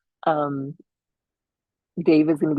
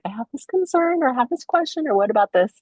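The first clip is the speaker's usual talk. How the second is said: high-pitched imitation of anxious askers; mock fretful voice, pitched way up; worried questioners; 9 semitones higher, 3 dB louder than usual. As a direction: pipe up like people with concerns. Voicing listeners reaching out with questions.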